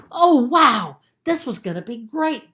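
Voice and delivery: loud, high burst of delight; a kid who can't believe his luck; thrilled boy. Acted. Ben thrilled by the deal.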